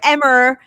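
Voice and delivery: "[name]" said loud as "Emmer"; imitated mockery, "[name]" becomes "Emm-err"; mocking R ending. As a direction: tease by ending "[name]" in a hard R. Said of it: "John made fun of me for years".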